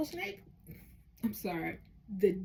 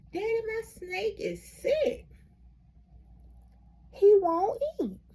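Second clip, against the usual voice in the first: put-on worried boy; 10 semitones higher, 4 dB louder, slower than usual. Boy worried about sick snake.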